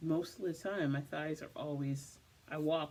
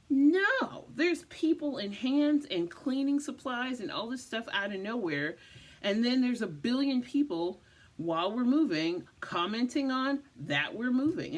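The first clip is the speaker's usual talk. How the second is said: louder and higher, exasperated rush; exasperated outburst; 8 semitones higher, 6 dB louder than usual. Real, not acted. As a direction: let the chaos spill out, worked up. Overwhelmed retelling hectic desk move.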